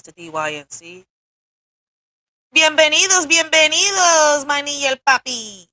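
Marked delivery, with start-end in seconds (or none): 2.5-5.7 loud teasing welcome. Pretend. Host teasing a viewer, loud playful Spanish welcome. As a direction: belt the Spanish welcome to tease him. He gonna kill me for that one.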